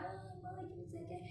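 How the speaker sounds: put-on high, soft whiny little-girl grumble; imitating toddler whining.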